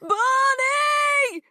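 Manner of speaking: long, high, loud scream of the name. A narrator's reading. Panicked girl screaming for her dog while running. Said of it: "she screamed".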